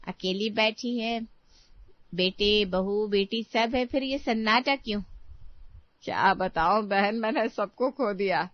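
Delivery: usual speaking voice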